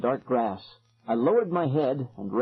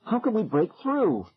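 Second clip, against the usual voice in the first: alarmed; 9 semitones higher than usual. A narrator's reading. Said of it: "I cried".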